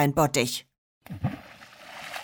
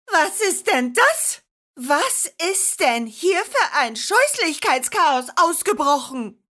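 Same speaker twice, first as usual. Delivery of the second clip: bellowing grandma; 7 semitones higher than usual. Acted. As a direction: bellow it across the whole house. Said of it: "brüllte Oma Marianne".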